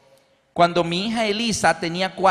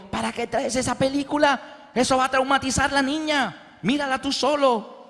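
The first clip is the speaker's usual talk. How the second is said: scolding wife; 7 semitones higher than usual. Retold or imitated. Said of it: "Mi esposa ... dijo".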